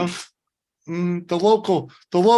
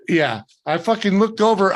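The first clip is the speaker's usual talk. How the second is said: ordinary talk, nothing marked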